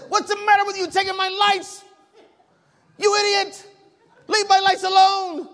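loud, very high-pitched outraged yell; angry confrontation. Acted. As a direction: shout it like you're confronting a thief on his doorstep. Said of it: "That's what I wanna say".

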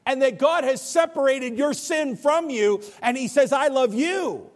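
high, forceful sermon voice; ringing, urgent gospel proclamation; impassioned preaching. Acted. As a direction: proclaim it with full conviction. Preaches forgiveness.

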